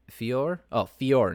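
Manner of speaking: "Fior" as fee-OR; correct pronunciation. Shown as a demonstration. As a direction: say "Fior" with a clear final R, fee-OR. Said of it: "It's pronounced Fior".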